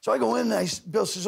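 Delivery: usual speaking voice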